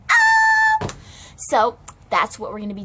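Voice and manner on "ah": sudden high-pitched shriek. Acted. Teacher shrieks as Starla gets ready, high scream. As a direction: let out a shrill scream.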